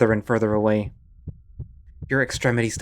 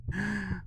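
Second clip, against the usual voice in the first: startled gasp; 9 semitones higher, 11 dB quieter than usual. Acted.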